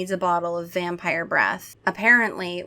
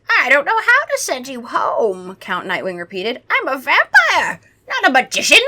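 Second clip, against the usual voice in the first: high, loud theatrical vampire; hammed-up vampire exasperation; exasperated vampire; 5 semitones higher, 6 dB louder than usual. A narrator's reading. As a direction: protest as a put-upon old vampire. Acting out exasperated old vampire.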